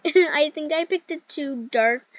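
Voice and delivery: usual speaking voice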